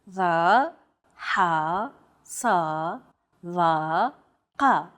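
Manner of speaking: Va, ha, sa, va, ka with consonants deep in the throat; throaty Arabic emphatics in Va, ha, sa, va, ka, each syllable set apart. Shown as a demonstration. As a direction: pronounce Va, ha, sa, va, ka from deep within the throat. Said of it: "they're pronounced deep within the throat".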